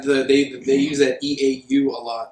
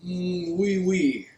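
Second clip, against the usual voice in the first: put-on French accent; 5 semitones higher, much slower than usual. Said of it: "I needed your French accent".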